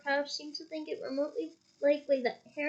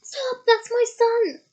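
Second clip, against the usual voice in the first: loud, high frantic shout; desperate yell; 8 semitones higher, 9 dB louder, slower than usual. A narrator's reading. Mr Weasley shouting to protect his son. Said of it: "yelled a voice".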